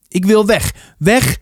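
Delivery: high, agitated repeated pleading; loud, upset insistence. A narrator's reading. Arie shakes his head, wanting to go home, loud upset insistence. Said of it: "Hij praatte nog steeds keihard".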